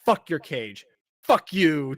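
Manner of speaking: loud mock outburst; angry cat yell. Cat swears at the cage and Tanuki, loud mock yell. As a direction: shout it at the cage. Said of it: "And the cat just goes".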